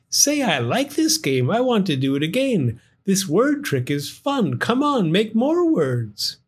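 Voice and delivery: high, playful little firefly; bouncy, wants to play again; eager firefly voice. Acted. Gus eager for more.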